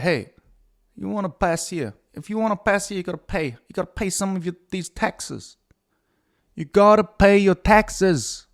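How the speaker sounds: Brooklyn gangster. Retold or imitated. Mock Brooklyn gangster demanding taxes from passers. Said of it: "it's not very Brooklyn".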